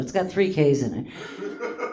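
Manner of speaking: usual speaking voice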